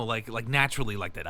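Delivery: ordinary talk, nothing marked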